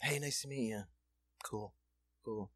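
put-on soft, offhand drunk greeting; drunk bar blowhard. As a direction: imitate a too-cool drunk, quietly. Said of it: "bar blowhards".

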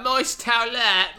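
hammed-up Cockney "moist towelette"; mock Cockney. Host imitating the club owner's band call, mock Cockney "moist towelette". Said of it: "but he says it like".

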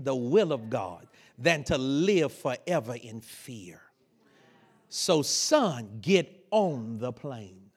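low, slow, measured father; solemn fatherly counsel. Retold or imitated. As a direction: impart grave wisdom to a son.

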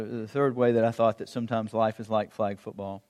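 ordinary talk, nothing marked

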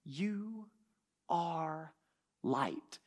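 "You... are... light", each word set apart, stepping down in pitch; emphatic. Retold or imitated. Preacher quoting Jesus on who his followers are, emphatic "are". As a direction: weigh "You", "are", "light" separately, pausing between. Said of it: "Emphatic present tense verb".

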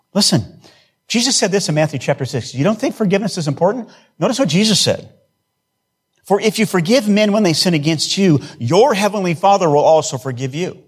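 preaching. Acted. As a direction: lift the voice and press the scripture home.

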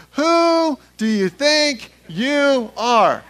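loud, high, slow chant-like challenge; out loud together. Shown as a demonstration. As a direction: lead a crowd in shouting it, word by word. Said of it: "Turn to your neighbor and say".

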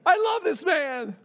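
high, drawn-out adoring cheer; gushing praise. Acted. Grateful worker praising the master, acted elation. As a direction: gush it like a delighted fan. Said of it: "imagine his praises coming from them".

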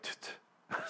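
whispered little thud imitation; a hushed tut tut for a muffled thud; soft thud noise. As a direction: mouth the soft camera landing, very quiet. Imitating camera landing.

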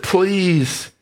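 a small helpless please; begging father. Acted. Lester ignoring the head mouse to plead with his son.

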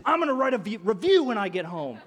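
loud, high indignant threat; hammed-up customer outrage; mock threat. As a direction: threaten it as if it were deadly serious. Mock threat of a modern angry customer.

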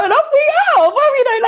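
high, loud, bubbly; high giddy delight; giddy excitement. Real, not acted. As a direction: squeal it out happily. Giddy retelling of street moment.

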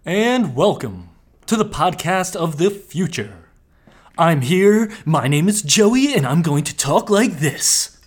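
commercial voice. Acted. Co-host introducing himself in a slow commercial announcer voice. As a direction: read it like a TV ad announcer. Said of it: "You sound like one of those commercial".

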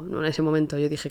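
normal talking voice

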